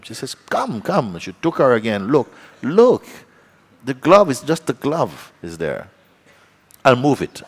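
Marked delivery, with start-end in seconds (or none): 0.3-1.2 high-pitched retold coaxing; insistent urging. Hostess urges reluctant friend back to the room, insistent. 2.1-7.4 high, loud, insistent demonstrating; exasperated proof. Retold or imitated. Hostess shows friend it is just a glove, insistent. Hostess proves cupboard is empty.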